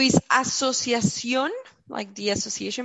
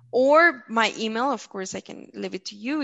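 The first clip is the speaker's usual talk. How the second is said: ordinary talk, nothing marked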